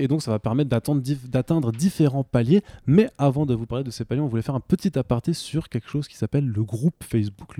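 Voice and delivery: usual speaking voice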